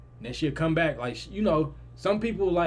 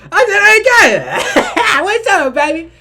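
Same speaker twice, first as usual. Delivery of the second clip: high, loud, drawn-out laughing squeal; overexcited greeting; 16 dB louder, much slower than usual. Retold or imitated. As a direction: holler hello through hammed-up laughter. Acts out greeting his aunt.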